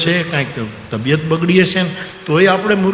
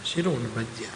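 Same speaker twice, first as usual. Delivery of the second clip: soft repeat; 6 semitones lower, 10 dB quieter than usual. Retold or imitated. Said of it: "શીરો અને ભજીયા કરાવવા છે".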